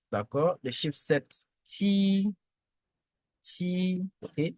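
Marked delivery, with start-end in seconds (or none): 1.6-4.0 "ti" high, long and level; sustained, unbending high "ti"; first tone. Shown as a demonstration. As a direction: hold "ti" on one high note. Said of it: "le ton constant, le premier ton".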